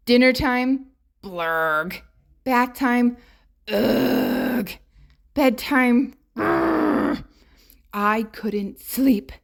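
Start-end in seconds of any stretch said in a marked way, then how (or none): 1.2-2.0 put-on a low, disgusted blurg of a child. Girl refusing dinner, disgusted groan. 3.7-4.7 put-on a grumpy childish ugh. Girl dreading bath time, grumpy groan. 6.3-7.2 a voiceless shivery brr; whispered brr. Acted. Girl balking at bedtime, whispered brr.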